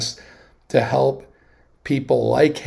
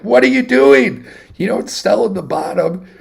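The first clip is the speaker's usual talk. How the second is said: higher and louder, incredulous at his friend; exasperated retold outburst; 7 semitones higher, 6 dB louder than usual. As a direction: scold him as if he just sold at the bottom. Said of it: "I'm like".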